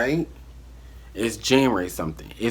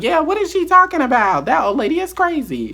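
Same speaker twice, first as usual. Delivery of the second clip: much higher, louder, mock-enthusiastic; fake hype; 4 dB louder than usual. Hyping her friend up against the old lady, high and loud. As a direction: pump her up with hollow cheer.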